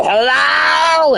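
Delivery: loud high call from an approaching car; hammed-up hollered greeting; imitated shout. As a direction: yell it out like someone hollering from a distance. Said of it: "I hear s-, the".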